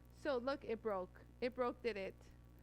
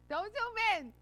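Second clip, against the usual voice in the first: higher, louder don't zoom in; loud playful call; 8 semitones higher, 8 dB louder, slower than usual. Real, not acted. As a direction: call out to the camera operator. Calling out to camera operator.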